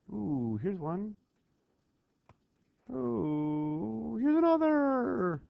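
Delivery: slow, high gleeful ooh; mock delight. Teacher showing students how to spot phrases, hammed-up glee. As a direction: play at finding treasure.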